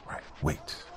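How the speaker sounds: unvoiced hushed wait; whispered halt in the jungle; whispered warning. A narrator's reading. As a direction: breathe it out so the enemy can't hear. Big guy halts in jungle.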